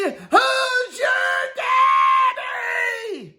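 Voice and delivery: high, slow, drawn-out victory yell; triumphant yell. Pretend. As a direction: roar it like a champion. Triumphant champion call.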